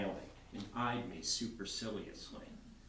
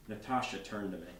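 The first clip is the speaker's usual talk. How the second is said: usual speaking voice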